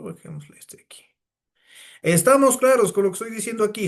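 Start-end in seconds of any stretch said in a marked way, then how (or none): 0.0-1.0 soft, half-voiced aside to himself; barely voiced note to self, much softer; quiet aside. Real, not acted. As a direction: mumble it under your breath while working. Muttering to himself at computer.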